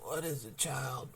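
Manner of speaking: put-on soft, quiet old voice; subdued. A narrator's reading. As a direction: ask it hushed and gently. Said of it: "asked in subdued tones".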